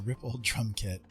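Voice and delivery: ordinary talk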